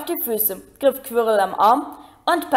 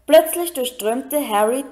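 usual speaking voice

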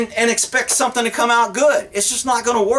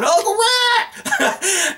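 drawn-out, much higher mock yell of a scared kid; panicked cry; 11 semitones higher, much slower than usual. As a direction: call out like a boy clinging to a runaway horse, half laughing. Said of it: "he was hanging on".

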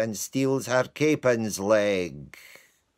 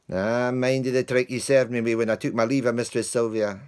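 loud, higher, indignant; scolding the dog; 5 semitones higher, 7 dB louder than usual. Acted. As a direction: tell the dog off properly. Lance scolding his dog.

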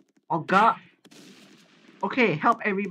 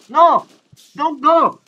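loud, higher protest; urgent call; 7 semitones higher, 10 dB louder, slower than usual. Real, not acted. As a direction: call out to stop someone leaving. Begs teammate not to leave.

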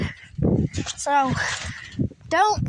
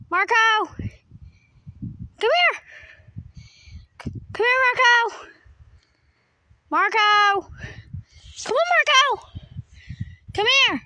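calling the dog; 4 semitones higher than usual. Real, not acted. Calling the dog across the yard.

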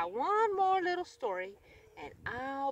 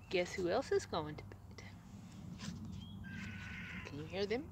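soft, low whisper; hushed bedtime whisper; 4 semitones lower, 8 dB quieter than usual. Real, not acted. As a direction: whisper so no one wakes. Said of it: "whispering to listener".

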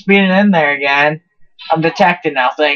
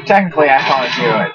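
ordinary talk

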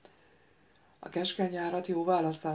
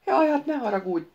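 higher, wheedling plea; pleading; 7 semitones higher, 6 dB louder than usual. Acted. The naked ghost begs the prince: pleading, higher. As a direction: beg forgiveness, pitched up. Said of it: "könyörgött a pőre szellem".

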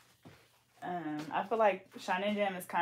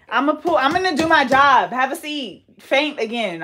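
higher, louder commands; bossy loud; 6 semitones higher, 10 dB louder than usual. Real, not acted. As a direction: boss her into the chair. Bossy braider ordering friend.

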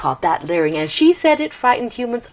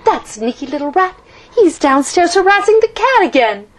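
exasperated; 10 semitones higher, 6 dB louder than usual. A narrator's reading. Oliver annoyed at the rat.

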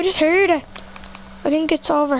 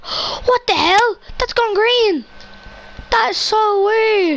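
surprised; 7 semitones higher, much slower than usual. Real, not acted. Surprised at something odd.

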